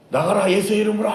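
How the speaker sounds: high, strained exorcism cry; commanding shout. Acted. Disciples retrying with the words reversed, commanding shout. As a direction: shout the whole command in one push.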